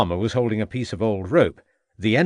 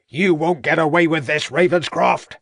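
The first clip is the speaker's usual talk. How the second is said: higher, loud defiant shout; 7 semitones higher, 5 dB louder than usual. A narrator's reading. Farmer shouting at the inspector while bundled into the cart. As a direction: hurl the threat back over his shoulder. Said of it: "shouted Troutbridge".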